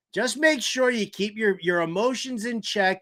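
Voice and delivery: ordinary talk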